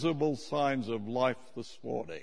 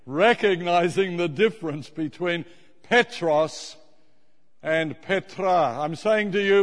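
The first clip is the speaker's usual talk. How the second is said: preaching; 4 semitones higher, 7 dB louder than usual. Acted. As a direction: preach every word like scripture. Preaching to the congregation.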